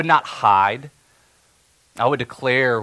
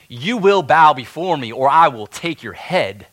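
louder, raised shouting command; yelled threat; 3 semitones higher, 8 dB louder than usual. Acted. Haman yells his threat at defiant Mordecai, loud. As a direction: bark the threat so the gate hears. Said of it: "and he yelled".

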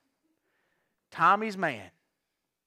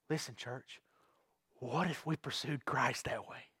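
hushed appeal; 6 semitones lower, 13 dB quieter than usual. Real, not acted. Preacher quietly asks the church to pursue Christ. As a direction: drop to a soft, low hush.

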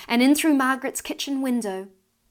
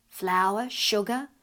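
ordinary delivery

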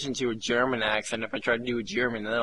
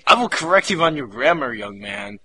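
loud, higher mock telling-off; raised-voice pretend reprimand; mock scolding; 5 semitones higher, 9 dB louder than usual. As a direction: playfully scold like a stern parent.

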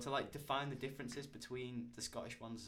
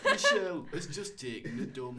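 mock Big Brother voiceover; 7 dB louder, slower than usual. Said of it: "The Big Brother".

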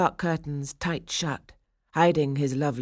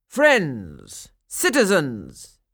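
pompous mayor; 5 dB louder, much slower than usual. Acted. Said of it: "he tried to quiet them".

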